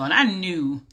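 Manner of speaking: ordinary talk, nothing marked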